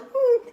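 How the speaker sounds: very high mock whimper "hmm"; little squeal. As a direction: imitate a tiny, high-pitched pained hum. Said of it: "it wasn't just a little".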